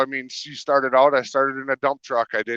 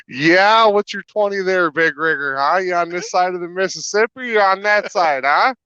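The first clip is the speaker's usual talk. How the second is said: trucker CB chatter, higher pitched; over cb radio; 6 semitones higher than usual. Pretend. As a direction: hail a big rig on the CB. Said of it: "give me some of your C B talk".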